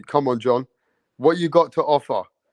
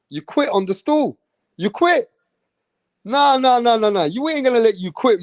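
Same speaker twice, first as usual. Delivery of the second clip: high, loud, incredulous taunt; loud goading; 8 semitones higher, 5 dB louder than usual. Real, not acted. Accusing Mirage of quitting on the stool, loud goading. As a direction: push the pitch right up and hector him.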